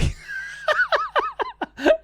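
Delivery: high burst of real laughter; cracking up at his own Xbox tag; big laugh. Real, not acted. Telling friends the story, a loud high laugh.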